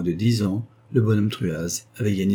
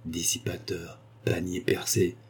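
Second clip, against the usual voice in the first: softer, muttered aside; muttered under breath; 3 semitones lower, 7 dB quieter than usual. A narrator's reading. Miser mutters insults about the baron, softly muttered. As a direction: grumble it under your breath. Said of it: "Puis tout bas, il murmura".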